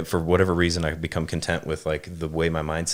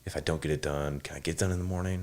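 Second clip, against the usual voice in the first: muttered, much softer, like thinking aloud to himself; inner-voice worry; 5 dB quieter than usual. Retold or imitated. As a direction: drop the voice and fret privately. Voicing his own worried thoughts.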